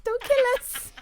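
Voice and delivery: high, loud mock-tiny plea. Friend repeating the voodoo doll punchline, imitated tiny voice. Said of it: "it was in tiny".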